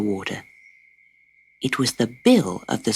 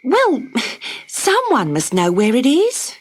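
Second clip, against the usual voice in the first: Dot losing patience, blurting it; bursting out; 10 semitones higher than usual. Acted. Dot bursts out at the bored platypus, high and urgent. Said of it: "she burst out".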